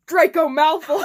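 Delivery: loud laugh breaking through the name. Real, not acted. Reader losing it at Draco's entrance, laughing. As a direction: say the name and crack up.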